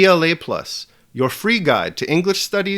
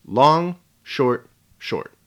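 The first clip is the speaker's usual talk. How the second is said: chant-like count of "Long, short, short", first word highest; dactyl pattern; much slower than usual. Shown as a demonstration. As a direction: stress "Long", let "short, short" drop away with pauses between. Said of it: "Just the pattern of the dactyl".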